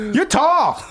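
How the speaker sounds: imitating the character's high excited shout; mock yell. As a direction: squeal it like a giddy coked-up guy. Imitating Barry yelling at girl.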